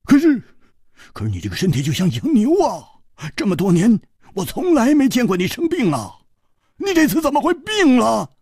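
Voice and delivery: fast, high, loud panicked questioning; agitated, rising to a shrieked question; frantic disbelief. Acted. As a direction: blurt it out, alarmed and high-pitched. Frantic at friend's sudden illness.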